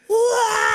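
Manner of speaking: very high-pitched mock "wah"; high wailing cry. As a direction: let out a comic high wail. Mimicking the man sprinting in.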